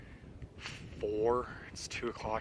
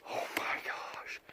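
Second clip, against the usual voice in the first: whispering; 7 dB quieter, slower than usual. Real, not acted. Finds giant elk shed, whispers.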